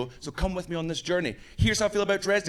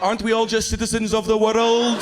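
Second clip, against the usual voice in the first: louder, higher mock Russian accent; 5 semitones higher, 6 dB louder than usual. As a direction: plead loudly as an evasive Russian. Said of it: "you sound like you might be Russian".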